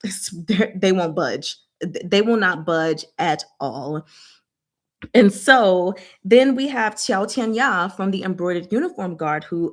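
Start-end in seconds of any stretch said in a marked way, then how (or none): none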